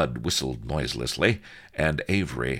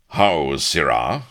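slow, loud, haughty challenge; dark displeasure; 8 dB louder, slower than usual. A narrator's reading. Avery rounds on Blood for interrupting, loud indignation. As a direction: demand it with a darkened brow. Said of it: "Avery's brow was dark".

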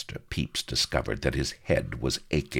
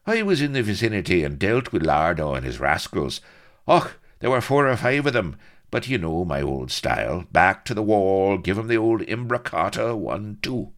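loud, higher, breezy bragging; careless boast; 8 semitones higher, 7 dB louder than usual. A narrator's reading. Blood recounting his fight to Avery, carelessly boastful. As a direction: brag as if it were routine. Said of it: "he added carelessly".

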